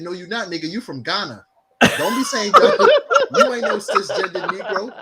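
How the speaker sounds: high, loud, laughing scolding; cracking up while calling him out; laughing high rant. Retold or imitated. Scolding his Ghanaian friend, high laughing rant. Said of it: "I said".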